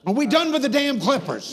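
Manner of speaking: loud, high, exasperated demand; exasperated shout. Real, not acted. Host to the barber over the clippers, exasperated shout. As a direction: cut through the laughter, fed up.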